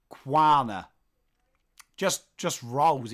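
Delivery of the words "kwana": northern accent. Shown as a demonstration. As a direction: let "Kwana" flow in northern tones. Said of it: "It flows in my northern accent".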